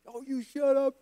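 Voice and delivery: high-pitched imitated brush-off; mock irritated retort. Gretzky brushing off the player's gripe, mock irritated retort. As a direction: playfully act out an annoyed comeback. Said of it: "he looked at me like".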